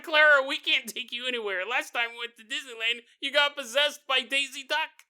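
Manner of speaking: exasperated family. Pretend. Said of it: "her family's like".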